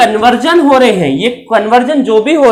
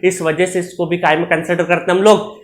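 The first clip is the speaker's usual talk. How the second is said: usual speaking voice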